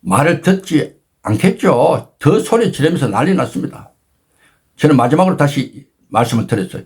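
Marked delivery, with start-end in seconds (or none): none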